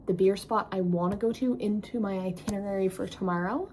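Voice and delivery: normal talking voice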